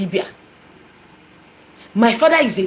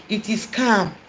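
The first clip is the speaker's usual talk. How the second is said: soothed murmur; 2 semitones lower, 7 dB quieter, slower than usual. Retold or imitated. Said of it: "my mother will be like".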